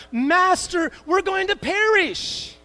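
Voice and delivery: high, drawn-out panicked plea; panicked cry. Retold or imitated. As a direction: cry out like sinking men. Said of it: "this is what came out of their heart".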